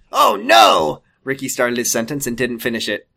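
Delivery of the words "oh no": shout. Acted. Panicked character blurting.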